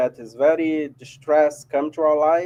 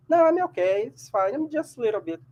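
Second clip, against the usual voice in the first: higher-pitched, dismissive reassurance; acted brush-off; 7 semitones higher than usual. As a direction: wave off concern in a higher, softening voice. Acting someone hiding their sadness.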